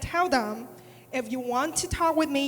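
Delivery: ordinary talk, nothing marked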